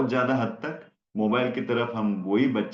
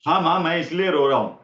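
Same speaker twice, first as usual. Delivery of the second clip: higher and louder, quick mock-obedient child voice; mock child reply; 5 semitones higher, 6 dB louder, faster than usual. Teacher mocking a child who answers mother instantly, higher. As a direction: play the child sarcastically answering at once.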